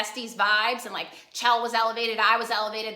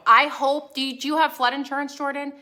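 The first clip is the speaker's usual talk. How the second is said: usual speaking voice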